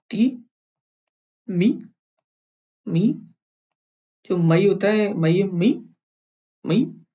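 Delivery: मी with a high narrow vowel, like मई; tricky Russian vowel. Shown as a demonstration. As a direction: stretch the ई of मी. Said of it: "तो ये थोड़ा मुश्किल है".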